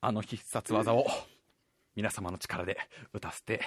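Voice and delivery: deep hushed build-up to a special move; solemn hero announcement. Pretend. (低く小さくゆっくり必殺技の前口上)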